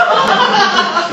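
short high-pitched cackling laugh; high cackle. Real, not acted. Comic laughing at his own punchline, high cackle. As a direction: burst out in a squeaky laugh.

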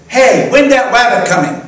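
put-on high, loud little-child voice; toddler voice. Pastor voicing the little girl asking when the rabbit comes, high toddler voice. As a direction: chirp it like an excited toddler.

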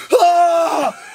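high, loud "aah" scream; imitated scream. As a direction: imitate his sudden scream of shock. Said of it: "He's screaming".

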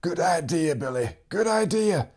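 put-on an old farmer's cracked, teasing voice; old man teasing. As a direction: rib him like a wheezy old friend. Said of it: "And the old man would say".

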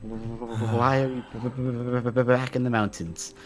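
slow, stuttering b-b-b on one tone; stammering shiver. Acted. Swimmer just out of the water, stammering.

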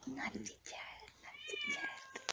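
put-on high, breathy sing-song whisper of naughty child. Shown as a demonstration.

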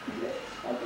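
voiceless whisper; stage whisper. Real, not acted. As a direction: whisper it, no voice at all. Mouthing word for lip-reading.

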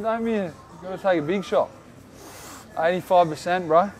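normal talking voice

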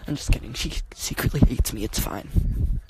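usual speaking voice

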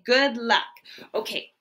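plain reading voice

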